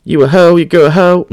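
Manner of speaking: mock drunk heckler. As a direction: ham up a drunk fan cursing. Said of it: "little [name] dude was like".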